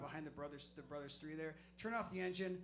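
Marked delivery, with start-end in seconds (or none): none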